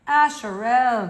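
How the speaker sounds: a firm, drawn-out call to wake him; strange loud voice. A narrator's reading. The Masfia calls the daydreaming Asherel, loud and strange. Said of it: "saying in a strange, loud voice".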